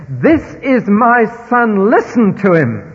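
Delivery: slow thundering divine proclamation; thundering voice from heaven. Retold or imitated. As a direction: boom it out like a voice from the heavens. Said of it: "And it thundered".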